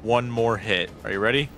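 normal talking voice

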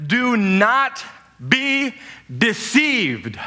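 each word set apart with pauses; slow emphatic reading. Shown as a demonstration. Preacher drilling scripture into the congregation. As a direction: etch every word in. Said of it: "Etch this into your minds".

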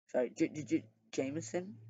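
a soft, nervous stammer on the name; stuttering. A narrator's reading. Chance, frightened by the hitman, stuttering. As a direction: trip over the J several times. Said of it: "Chance stuttered".

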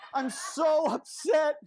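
hammed-up upset; mock upset. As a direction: stretch it out like a sulky child. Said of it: "but I'm like".

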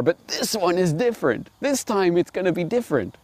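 high, mocking starry-eyed voter; mock hopeful voter. Mocking a naive voter cheering for Tulsi. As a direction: parody the naive believer, sing-song.

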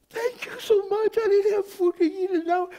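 very high, loud, hammed-up gushing; overwhelmed gratitude. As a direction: imitate a stranger shrieking thanks. Retold grateful stranger gushing loudly.

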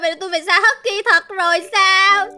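mock crying. Owner told to release the Husky, acted crying. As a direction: whine through pretend sobs. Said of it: "có gì đâu mà khóc thấy ghê vậy".

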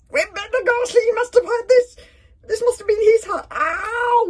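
very high, loud wail; panicked squeal. A narrator's reading. Fishlegs recognising the dead pirate's hut, panicked. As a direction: shriek it in alarm.

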